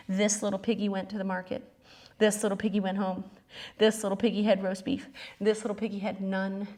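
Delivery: put-on soft, quick rhyme for a child; nursery rhyme. Instructor reciting the piggy rhyme to kids, soft and quick. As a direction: hush it like a bedtime rhyme.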